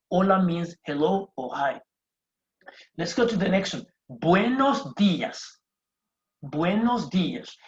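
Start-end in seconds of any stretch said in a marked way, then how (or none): 4.1-7.6 slow, drawn-out "Buenos dias" leaning on the I of "dias"; "dias" stressed as DEE-as. Shown as a demonstration. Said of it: "meaning there is a stress on that".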